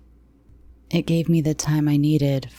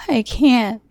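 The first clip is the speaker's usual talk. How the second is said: high, tearful begging; 8 semitones higher, 4 dB louder than usual. Acted. Captive begging the captor not to make her. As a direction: plead in tears. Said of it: "I begged".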